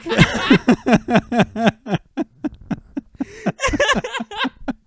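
high, long shrieking laugh; loud laughter. Real, not acted. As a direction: burst out cackling. Big laugh.

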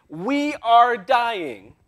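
mock panic from the boat; panicked apostles. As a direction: wail it like men sure they'll drown. Panicked apostles waking Jesus.

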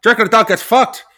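mock Irish traveller voice; imitating [name] Pitt's Irish accent from Snatch. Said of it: "you're marginally less terrible at this accent, [name]".

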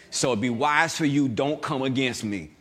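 ordinary talk, nothing marked

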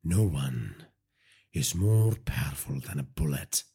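low, controlled, deadpan delivery; emotionless. A narrator's reading. Virat answers Nair's fears about Rahman, without betraying emotion. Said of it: "without betraying any emotion".

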